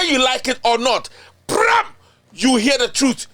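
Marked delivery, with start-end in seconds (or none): 1.5-1.9 catchphrase shout. Acted. Show catchphrase called out.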